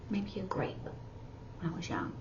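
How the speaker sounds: a guilty little whisper; whispered confession. Pretend. Sign teacher playing a fibber admitting a grape theft, whispered.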